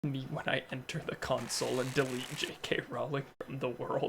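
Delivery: hushed unvoiced whisper. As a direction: breathe it in a menacing whisper. Joke villain line.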